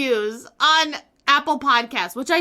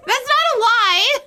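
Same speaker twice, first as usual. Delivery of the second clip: laughing; 11 semitones higher, 5 dB louder than usual. Real, not acted. Laughing after review.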